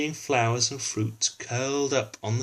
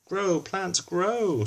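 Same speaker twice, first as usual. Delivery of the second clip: high, commanding queen call; 8 semitones higher, much slower than usual. Acted. Ruth repeats her command.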